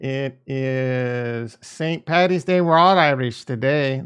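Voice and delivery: slow, lightly put-on Irish lilt; a quick hammed-up Irish accent for the holiday; a deliberately terrible Irish accent, drawn out slow; bad Irish accent. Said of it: "That was a terrible Irish accent".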